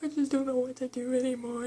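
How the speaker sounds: mildly imitated tearful dad; retold crying. Dad at a loss with his daughter, mock crying. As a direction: lightly mimic someone starting to cry. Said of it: "he starts crying".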